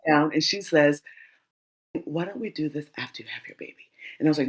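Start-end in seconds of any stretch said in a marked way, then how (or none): 2.1-3.9 soft unvoiced whisper of the doula; retold whisper. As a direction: whisper it gently, like a secret. Midwife says it gently, retold.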